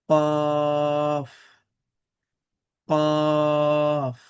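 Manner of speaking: কফ from the back of the throat, heavy; deep throat qaf. Shown as a demonstration. Teacher modelling throat কফ for students. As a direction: push কফ down at the tongue root. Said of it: "হলক থেকে একটু আওয়াজ করে".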